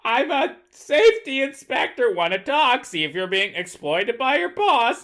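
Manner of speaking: high, nasal nerd voice; nerdy voice. Retold or imitated. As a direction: imitate a nerdy inspector. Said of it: "Harrison Ford pretend to be a nerd".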